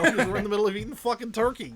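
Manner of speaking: laughing it out, loud and fast. Real, not acted. Joking to co-host about the turkey-week release, laughing loudly. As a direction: spill the words through real laughter.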